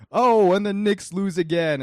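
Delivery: loud, high-pitched cry of dismay; imitated frustrated yell; mock exasperated outburst. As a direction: act out a player groaning over a miss. Acting out player missing dunk.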